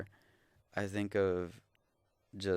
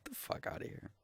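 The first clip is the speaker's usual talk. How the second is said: exasperated aside; 13 dB quieter, faster than usual. Real, not acted.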